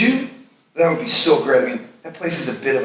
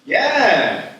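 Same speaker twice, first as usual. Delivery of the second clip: excited; 10 semitones higher, 3 dB louder than usual. Acted. Excited friend showing new apartment.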